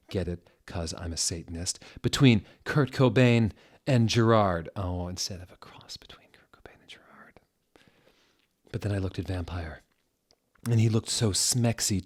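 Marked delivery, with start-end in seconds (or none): 4.8-7.4 fast, very soft whisper; quick hushed correction; whispered aside to listeners. Real, not acted.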